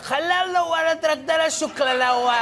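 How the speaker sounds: high, loud mock-Arabic speechifying; Donald Trump. Comedian imagining an Arabic-speaking leader, blustering like Trump. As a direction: gibberish Arabic with a blustering politician's cadence. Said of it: "Trump would be exactly the same even if he spoke Arabic".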